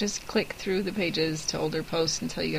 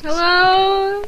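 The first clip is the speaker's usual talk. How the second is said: silly voice; 11 semitones higher, 8 dB louder than usual. Pretend. Answers with own silly voice.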